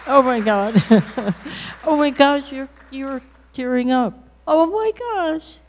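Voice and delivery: high, loud emotional exclamations with laughter; overwhelmed, touched. Real, not acted. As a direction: gush in real overwhelmed amazement. Moved reaction after tape plays.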